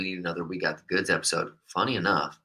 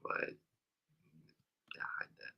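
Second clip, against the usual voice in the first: whispering; 16 dB quieter than usual. Real, not acted. Quiet aside during livestream.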